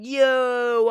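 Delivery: loud, high, cocky greeting; mock cocky yo. Show-off kid barges in on the boys with a loud cocky yo. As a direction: swagger in with a big loud yo. Imitating kid barging in boastfully.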